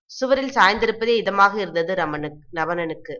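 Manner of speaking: ordinary delivery, nothing marked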